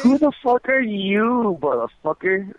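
slow, high-pitched angry challenge; slow angry demand. Real, not acted. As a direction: spit each word out slowly, fed up and furious. Angry caller demanding the prank caller's name.

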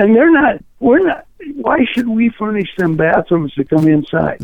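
worked up and pitched high; freaking out. Real, not acted. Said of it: "you're just freaking out at me".

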